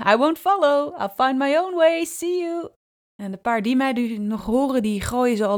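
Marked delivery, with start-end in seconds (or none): 0.1-2.7 calling out. Retold or imitated. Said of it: "roep ik".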